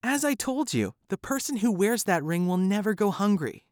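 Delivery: high, pleading wife voice; lightly voiced upset woman; distressed wife. Wife speaking.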